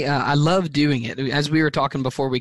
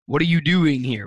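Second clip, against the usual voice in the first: "doing" punched harder than the other words; stress on doing; 2 semitones higher than usual. Shown as a demonstration. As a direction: hit the word "doing". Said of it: "putting the emphasis on the different words".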